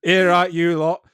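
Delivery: put-on broad, booming Yorkshire accent, slow; Henry the Eighth Yorkshire accent. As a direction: bellow it like a bluff Yorkshire king. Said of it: "a ribald Yorkshire accent".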